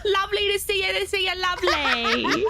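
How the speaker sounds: cutesy greeting. Acted.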